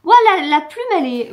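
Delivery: high, loud, gushing "Ouah"; mock gushing reviewer. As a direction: gush like her own over-the-top book review. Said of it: "dans mes chroniques, vous devez voir des fois".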